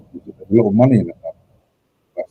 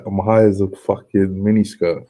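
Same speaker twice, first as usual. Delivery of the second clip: ordinary delivery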